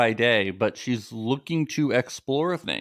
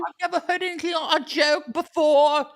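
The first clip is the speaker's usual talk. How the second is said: mock giggly girl. Mocking a cast member laughing at a dumb shirt, high giggly voice. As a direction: cackle like a ditzy girl finding it hilarious.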